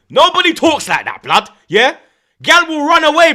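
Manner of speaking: normal talking voice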